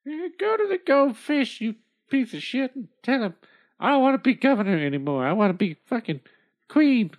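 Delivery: put-on bossy old woman. Old woman orders husband.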